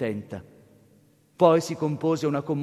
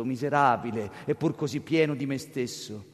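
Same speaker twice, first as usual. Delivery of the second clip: ordinary delivery, nothing marked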